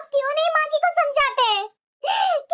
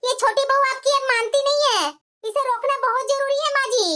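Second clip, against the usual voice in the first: ordinary talk, nothing marked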